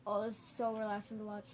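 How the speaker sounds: normal talking voice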